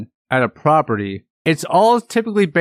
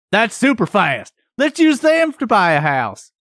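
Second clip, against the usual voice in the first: mock dumb person voice; 7 semitones higher than usual. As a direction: play a gullible fool, pitch rising up high. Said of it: "mimicking what a dumb person sounds like".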